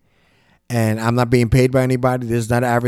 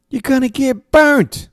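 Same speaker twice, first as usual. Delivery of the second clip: warning; 13 semitones higher, 4 dB louder, slower than usual. Retold or imitated. Warning punchline to the kid.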